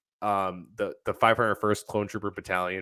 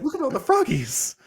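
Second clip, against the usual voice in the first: hammed-up little-creature delight; excited Grogu. Co-host playing Grogu wowed by lifted frogs, excited squeal.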